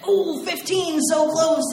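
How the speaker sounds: radio DJ. Retold or imitated. DJ telling caller fifteen is so close, teasing.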